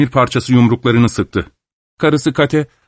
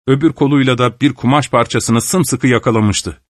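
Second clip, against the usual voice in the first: plain reading voice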